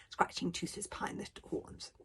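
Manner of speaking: ordinary delivery, nothing marked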